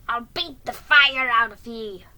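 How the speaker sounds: loud, rough threat; enraged shout. A narrator's reading. Jiggy raising his whip at the horse, enraged shout. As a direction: shout it in a rage. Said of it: "shouted Jiggy in a rage".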